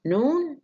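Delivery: noon jumps far up; high rise on noon. Shown as a demonstration. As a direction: swing noon right up high. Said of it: "rising at". (noon بنغمة صاعدة عالية)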